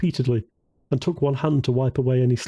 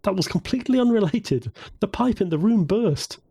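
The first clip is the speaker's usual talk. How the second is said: higher-pitched teasing protest; 8 semitones higher than usual. A narrator's reading. Woman answers her friend's dig, teasing. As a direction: pitch it up, mock-defensive and teasing. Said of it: "Samantha teased, chuckling".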